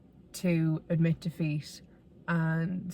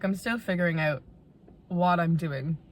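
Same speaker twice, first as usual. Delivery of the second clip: usual speaking voice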